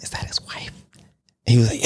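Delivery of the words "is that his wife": whispered, conspiratorial question. Retold or imitated. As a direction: lean in and whisper it so nobody hears. Secretly asking the guard.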